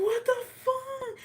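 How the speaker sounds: very high, drawn-out mock squeal; shocked disbelief. Daughter reacting to being insulted by her mom, shocked disbelief. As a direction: squeak it out slowly in exaggerated disbelief.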